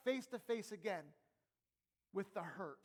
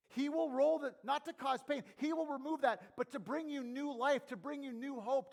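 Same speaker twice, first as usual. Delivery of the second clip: raised, louder preacher's voice; full-force preaching; 6 semitones higher, 6 dB louder than usual. Real, not acted.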